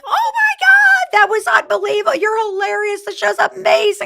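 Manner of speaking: high breathless rave from the team; gushing colleagues. Retold or imitated. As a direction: squeal it like thrilled fans raving after the show. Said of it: "everybody came back and were like".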